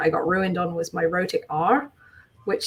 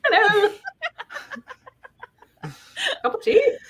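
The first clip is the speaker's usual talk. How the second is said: put-on British baby. Pretend newborn asking for tea, high British baby voice. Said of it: "Baby with a British accent".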